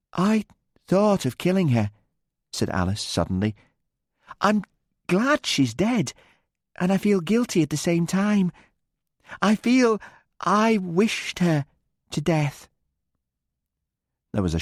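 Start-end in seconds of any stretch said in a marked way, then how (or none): 0.1-1.9 put-on high, tense young woman's voice; high-pitched guilty confession; Alice, pained. A narrator's reading. 4.4-12.7 put-on high, tense young woman's voice; high-pitched guilty confession; Alice, pained. A narrator's reading.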